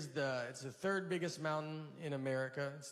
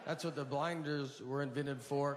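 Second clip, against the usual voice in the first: normal talking voice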